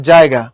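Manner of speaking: জায়গা opening on a firm j; pressed j. Shown as a demonstration. Teacher giving an example, জায়গা with pressed j. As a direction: press the first sound of জায়গা into a j. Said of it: "এটার উচ্চারণ চাপ দিয়ে হয়".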